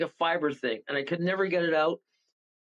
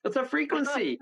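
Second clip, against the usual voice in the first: alarmed exclamation; 9 semitones higher than usual. Retold or imitated. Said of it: "I'm going".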